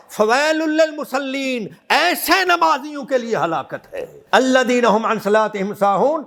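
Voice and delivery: high, ringing sermon delivery; forceful preaching. Real, not acted. Preacher admonishing the congregation about feeding the hungry, forceful preaching. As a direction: preach it hard, pitch held up high.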